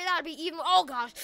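ordinary delivery

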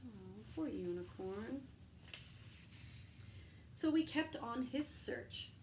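plain reading voice

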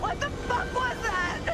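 high, slow, shocked; shaken disbelief. Acted. Survivor reeling after the attack, slow and terrified. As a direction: gasp it out, terrified.

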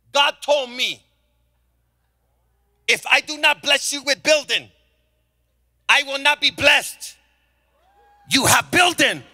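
put-on high, loud Korean-accented proclamation; shouted mock Korean accent; imitated Korean accent. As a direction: declare it forcefully as a Korean owner in broken English. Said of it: "He's a Korean gentleman".

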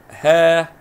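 ها held as a loud "haa"; long vowel. Shown as a demonstration. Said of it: "مد بالألف".

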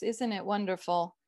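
ordinary delivery

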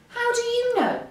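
very high, indignant challenge; angry. A narrator's reading. Frog challenges doubting Hare, angry. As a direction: demand it crossly. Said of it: "said Frog angrily".

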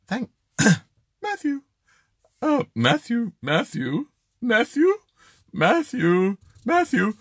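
high, put-on feminine voice; badly faked lady voice. Host as Karen thanking for the hot tub, badly faked lady voice. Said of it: "I can't do the ladies' voices".

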